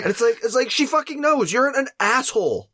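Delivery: high-pitched exasperation; exasperated high voice. Real, not acted.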